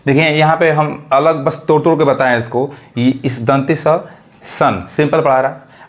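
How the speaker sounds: ordinary talk, nothing marked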